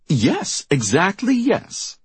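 high, emphatic agreement climbing in pitch; eager agreement. A narrator's reading. Trin agreeing on air with the other anchor, eager. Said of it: "Trin agreed".

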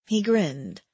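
usual speaking voice

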